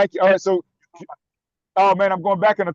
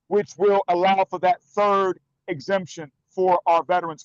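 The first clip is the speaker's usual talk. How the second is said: ordinary talk, nothing marked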